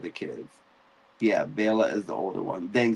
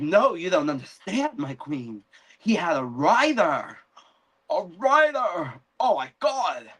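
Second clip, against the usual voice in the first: higher, urgent pleading; insistent messenger; 6 semitones higher than usual. Retold or imitated. The messenger insisting to the queen, in a higher urgent voice, about a rider. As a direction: press the news on the queen.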